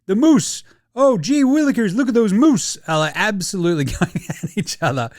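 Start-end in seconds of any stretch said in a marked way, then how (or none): 0.0-2.8 loud, high-pitched mock Canadian; Canadian accent. Canadian players going at each other, exaggerated Canadian accent. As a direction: ham up a cartoon Canadian accent. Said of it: "They've just gone full Canadian".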